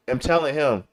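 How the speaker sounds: ordinary talk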